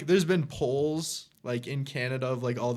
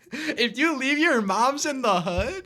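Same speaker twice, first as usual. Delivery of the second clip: high, slow voice through laughter; laughing imitation of a viral clip; quoting a viral clip; 8 semitones higher, slower than usual. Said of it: "that one clip with the girl".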